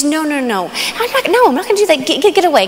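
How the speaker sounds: high, frantic no, rising into a cry; panicked pleading. Acted. Girl fending off the neighbor, high frightened pleading. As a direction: beg him to get away, voice jumping up. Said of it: "She says".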